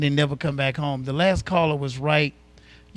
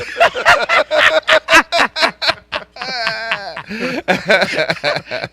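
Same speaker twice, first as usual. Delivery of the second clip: big laugh; 8 semitones higher, 7 dB louder than usual. Real, not acted. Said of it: "King D is sharp".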